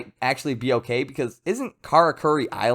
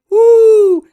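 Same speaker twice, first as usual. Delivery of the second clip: high, loud "woo!"; long hyped-up whoop, far above talking pitch; excited whoop; 15 dB louder than usual. Real, not acted. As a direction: let out a piercing, drawn-out woo. Hyped over imagined laser barrage.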